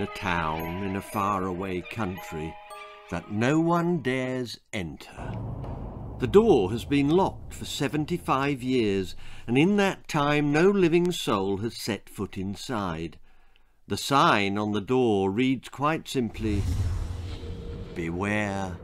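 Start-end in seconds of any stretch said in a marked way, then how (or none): none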